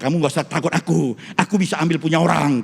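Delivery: ordinary delivery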